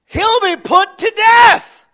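high, slow, roaring shout; furious death sentence. Retold or imitated. King David condemning the rich man to death, roaring shout. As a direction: bellow the verdict like a king.

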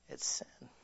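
breathy whisper, barely voiced; whispered verdict. Real, not acted. Preacher whispering the verdict to the congregation. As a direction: let it fall to a hush.

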